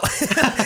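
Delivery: high bright laugh bursting out; burst of laughter. Real, not acted. Laughing off the question from the hosts. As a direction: laugh at your own dodge.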